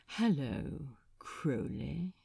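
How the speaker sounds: soft, low, breathy whispered greeting; languid seductive whisper. Acted. Asmodeus greets his rival Crowley, low seductive whisper. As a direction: purr it slowly like a predator savoring prey. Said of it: "slowly and languidly and lasciviously".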